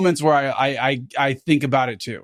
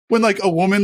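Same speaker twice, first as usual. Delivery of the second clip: normal talking voice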